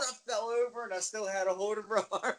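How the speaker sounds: high-pitched telling that breaks into laughter; tickled by your own story; amused telling. Real, not acted. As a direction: tell it on the edge of cracking up.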